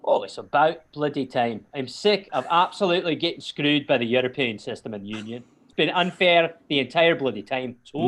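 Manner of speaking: put-on Scottish accent. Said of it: "what did they say".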